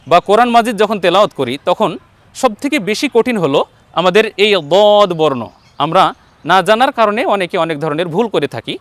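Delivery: ordinary delivery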